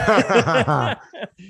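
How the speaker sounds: a loud, high burst of laughter; big laugh. Real, not acted. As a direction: laugh out loud before answering. Laughing before answering the question.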